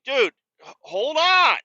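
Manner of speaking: high, loud mock order to wait; exasperated holler. Owner answers dog tangled at the mailbox, exasperated holler. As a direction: holler at a dog that keeps pulling. Acted reply to the stuck dog.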